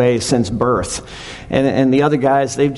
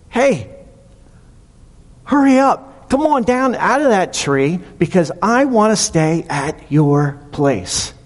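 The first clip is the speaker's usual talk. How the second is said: calling out; 5 semitones higher than usual. Retold or imitated. Jesus calls Zacchaeus down.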